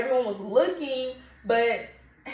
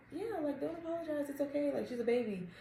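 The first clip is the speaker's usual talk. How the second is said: lightly voiced, high and soft reassurance; reassuring doctor staff; 6 semitones higher, 8 dB quieter than usual. Doctor's staff telling anxious mom not to apologize, soft. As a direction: imitate the nurses brushing it off. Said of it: "They're like".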